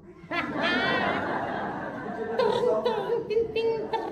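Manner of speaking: high, long laugh; high amused laugh; real laughter. Real, not acted. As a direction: burst out laughing. Medium laughing at spirits.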